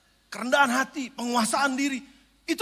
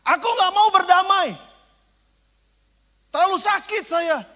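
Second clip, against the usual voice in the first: louder, higher wounded refusal; hurt refusal; 5 semitones higher, 7 dB louder than usual. Retold or imitated. Someone refusing peace, a loud hurt protest. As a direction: cry out that it hurts too much.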